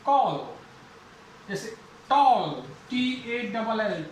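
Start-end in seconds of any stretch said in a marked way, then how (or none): 0.0-0.4 कॉल with a long, rounded o. Shown as a demonstration. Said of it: "आवाज देर तक जाएगी". 2.1-2.6 टॉल slow, with the o held long; long rounded o. Shown as a demonstration. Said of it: "आवाज देर तक जाएगी".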